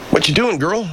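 high cooing to a cat; pet talk. Real, not acted.

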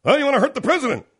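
very high, loud and fast; host imitating Matthews in a frantic squeal; mock panicked anchor. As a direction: screech the question in mock alarm. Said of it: "Matthews says".

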